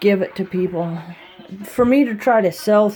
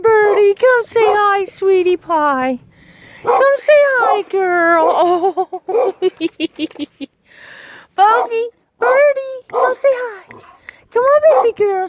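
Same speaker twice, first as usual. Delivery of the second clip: much higher, gentle coaxing; high coaxing pet talk; 12 semitones higher, 4 dB louder than usual. Acted. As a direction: coax a shy pet to come closer. Coaxing shy bird.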